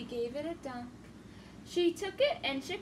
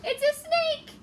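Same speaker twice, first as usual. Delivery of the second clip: high, loud panicked yell; leopard's child runs off in fright, yelling; 10 semitones higher, 7 dB louder than usual. Acted.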